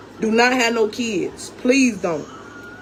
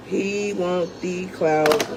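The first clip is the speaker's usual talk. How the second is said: slow and drawn out; 6 semitones lower, much slower than usual. Real, not acted. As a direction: stretch every word of He want the clout, disgusted.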